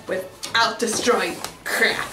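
ordinary talk

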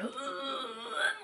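high, strained groan held long; straining push groan. Shown as a demonstration. Showing a contraction's urge to push, strained groan. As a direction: bear down with a tight straining moan. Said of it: "you're having to go".

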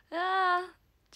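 sudden self-conscious groan; embarrassed groan. Real, not acted. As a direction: let out a real embarrassed "aah". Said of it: "ちょっと恥ずかしくなってきた。".

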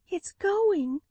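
high despairing cry. A narrator's reading. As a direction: cry out as it falls. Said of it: "cried Lucille".

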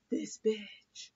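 whispered disbelief after a gasp; shocked whisper. Retold or imitated. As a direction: whisper it, appalled. Retold shock at the show.

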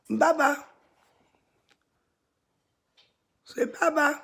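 pet teaching voice. Real, not acted. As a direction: coax the bird to repeat it. Teaching bird, louder.

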